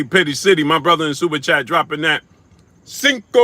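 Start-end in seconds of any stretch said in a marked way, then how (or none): 2.8-3.4 hyped. Real, not acted. Hyping super chat amount.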